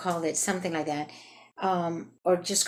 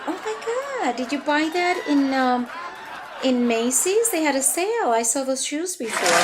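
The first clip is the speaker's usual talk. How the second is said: overly sweet compliment; 7 semitones higher, 3 dB louder than usual. Retold or imitated. Fake-nice compliment in elevator.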